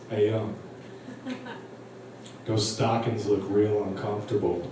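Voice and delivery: low, smooth and cool; casual, suggestive remark in an imitated rapper voice. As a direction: keep it low and laid-back. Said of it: "real smooth, real cool".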